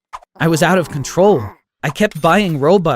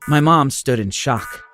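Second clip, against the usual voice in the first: plain reading voice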